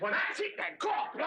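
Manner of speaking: loud, high exasperated outburst; fed-up outburst. Acted. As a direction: blow up and storm off. Storming out of a row.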